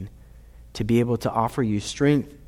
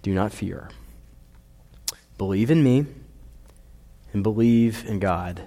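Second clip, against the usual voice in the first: soft, calm and reassuring; quiet comfort to frightened friends; calm reassurance; 4 semitones lower, 4 dB quieter than usual. Acted. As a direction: speak it gently, to settle their fear. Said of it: "he just calmly said".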